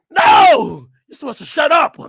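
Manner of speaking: snapping back at him, mocking; mock outburst shutting someone up; mock yell. Mock reply to West Coast rapper.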